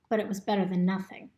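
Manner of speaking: usual speaking voice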